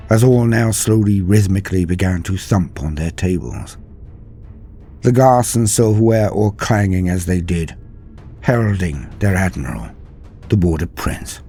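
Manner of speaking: ordinary delivery, nothing marked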